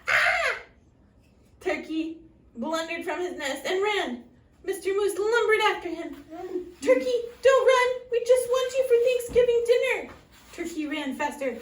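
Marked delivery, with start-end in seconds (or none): 0.1-0.6 high startled squawk. Acted. Turkey startled cry. 6.8-10.1 high, loud chasing call. Acted. Moose calling after fleeing turkey.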